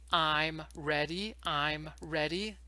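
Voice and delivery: "I'm ready" drawn out, each word separate, rising at the end; emphatic modelling. Shown as a demonstration. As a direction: model "I'm ready" slowly and clearly for a learner. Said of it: "this is how you emphasize".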